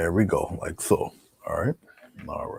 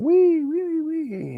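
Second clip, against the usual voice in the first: high squealing wee wee wee; commercial pig squeal; 4 dB louder, slower than usual. Retold or imitated. Painter reminding viewers of the commercial pig, high squeal. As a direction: squeal like a pig with its head out the window. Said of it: "He's going".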